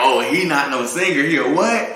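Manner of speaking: high, loud mock disbelief at a singer; mocking doubter voice. Guest mimicking a doubter who says he cannot sing. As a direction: scoff like a doubter who calls him no singer. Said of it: "he was like".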